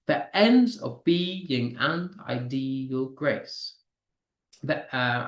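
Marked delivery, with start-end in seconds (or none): 0.1-3.8 very slow, "ENDS", "BE", "AND", "DE", "GRACE" stressed; stressed syllables. Shown as a demonstration. Teacher scanning a sonnet line aloud, syllables stressed in turn. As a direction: pick out every second syllable of "ends of being and ideal grace". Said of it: "stressing the syllables so you can hear them".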